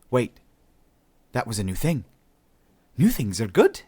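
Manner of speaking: lifted, eager self-talk; perking up. A narrator's reading. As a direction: perk up with hopeful self-talk. Talking to herself on spotting something.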